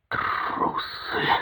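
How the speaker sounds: voiceless, venomous hiss. A narrator's reading. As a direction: hiss the insult under your breath. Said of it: "прошипел Рябой". (злобное шипение без голоса)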